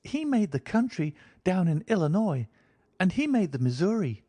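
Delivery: put-on high, childlike voice of a thirsty girl; a small girl speaking innocently; little girl. A narrator's reading. Girl asks her companion for water, childlike.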